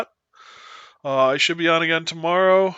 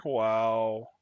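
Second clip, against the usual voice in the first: ordinary delivery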